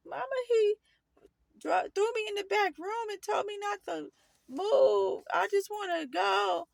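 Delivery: high, whiny imitated plea; pleading child. Teen phoning mom at work, high whining plea.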